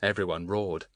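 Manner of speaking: usual speaking voice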